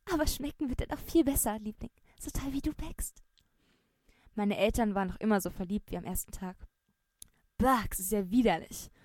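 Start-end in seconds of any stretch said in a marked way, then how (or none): 0.1-3.2 sweet, fading to a whisper; coy answer to her husband, trailing off into a whisper; flirty reply. Acted. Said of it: "wurde rot und kicherte zurück". 7.6-8.9 disgusted. Acted. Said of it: "wirkte mein Bruder angewidert".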